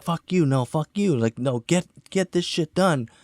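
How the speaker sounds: high-pitched mock quarrel on set; retold heated argument. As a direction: imitate a crew squabbling under pressure. Acts out heated argument during production.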